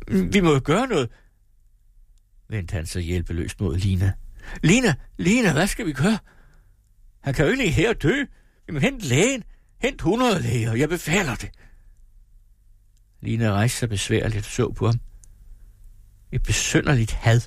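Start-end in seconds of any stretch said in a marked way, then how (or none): 0.1-11.5 helpless father, much higher and urgent; panicked father. Acted. Helpless father to Lina, high and panicked. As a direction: beg Lina to do something.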